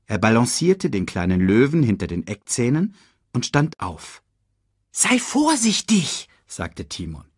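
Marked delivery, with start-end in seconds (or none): 4.9-6.3 high, slow meerkat warning; cautioning meerkat. Acted. Meerkat to warthog carrying the cub, high slow caution.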